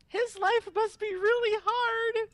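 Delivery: slow, loud, high-pitched mock wail; crying. Player overcome facing awkward boy, hammed-up crying. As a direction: blubber it out, exaggerated. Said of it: "I just started crying".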